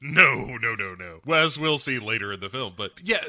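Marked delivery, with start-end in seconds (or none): none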